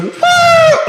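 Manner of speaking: very high, loud whoop; retold cheer. Telling how he cheered alone at the movie, high excited whoop. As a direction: re-enact a solo cheer in the cinema. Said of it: "I cheered by myself".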